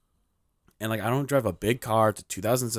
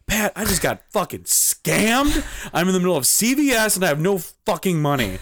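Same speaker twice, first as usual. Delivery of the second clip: exasperated rant; 8 semitones higher, 4 dB louder than usual. Retold or imitated. Telling friend about being scammed, exasperated. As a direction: rant it high, hammed up for the story.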